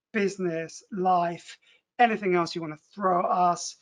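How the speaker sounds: ordinary talk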